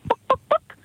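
high, soft hen clucks; clucking chicken. Acted. Chicken asking the librarian at the counter, clucking.

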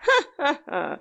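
a short, mocking laugh; sly laugh. A narrator's reading. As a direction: laugh once, knowingly, at the sick man. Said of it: "L'altro si mise a ridere".

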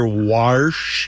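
"warsh" with an ah vowel and clear R; dialect pronunciation. Shown as a demonstration. Host demonstrating what people say, "warsh" with ah plus R. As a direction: say "warsh" to rhyme with marsh. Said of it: "And you do hear".

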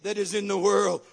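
loud, high, shouted exhortation; rally the mothers with full force; preaching at full force. Real, not acted. Preacher urging mothers to have their kids speak faith, shouting.

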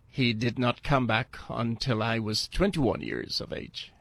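ordinary talk, nothing marked

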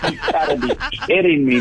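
ordinary talk

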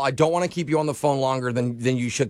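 ordinary talk, nothing marked

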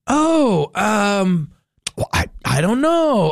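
high, hesitant mock of coworkers caught unprepared; a lightly voiced, dithering coworker; clueless coworkers. Host imitating coworkers stumped by the bartender, high and hesitant. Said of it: "my coworkers went".

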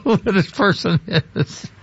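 laughing through the mock announcement; cracking up. Host retelling airline clerk's announcement, breaking up laughing. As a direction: break into laughter mid-sentence.

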